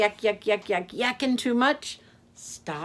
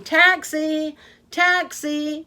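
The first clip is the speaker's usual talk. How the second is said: loud, higher hail across the street; shouting for a taxi; 8 semitones higher, 9 dB louder, slower than usual. Acted. Camel shouting to hail Yak's taxi, loud calling. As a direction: flag down the cab. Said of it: "waving her arms and shouting".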